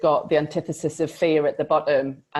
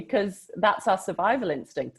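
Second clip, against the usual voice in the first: ordinary talk, nothing marked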